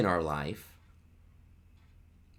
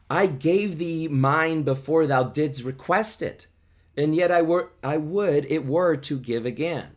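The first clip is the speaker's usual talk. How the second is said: passionate vow; 6 semitones higher, 5 dB louder than usual. Acted. Juliet declares love to Romeo.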